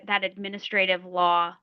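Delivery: ordinary talk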